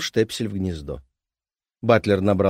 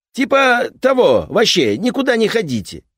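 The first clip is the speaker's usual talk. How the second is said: gruff repairman; 13 semitones higher, 8 dB louder than usual. Acted. Repairman keeps talking.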